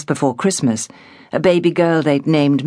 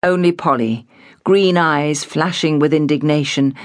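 plain reading voice

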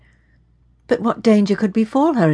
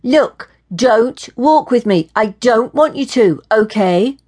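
firm, raised, emphatic voice; confronting; 5 semitones higher, 5 dB louder, slower than usual. A narrator's reading. Woman confronting the man who follows her, firm and annoyed. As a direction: lay down the law, higher and louder. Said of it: "Maddie confronted him".

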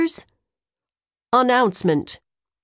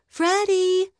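high call across the playground; 9 semitones higher than usual. Acted. Maria calling for Freddy at recess, mild call. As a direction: call for a friend you cannot see. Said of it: "Maria called for me".

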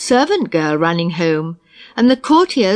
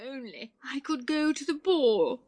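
wistful sigh; 4 semitones higher, 13 dB quieter than usual. Acted. Cinderella wishing, sad at home.

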